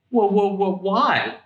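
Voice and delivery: put-on high startled cry of the children; startled whoa. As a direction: pitch jumps up in alarm.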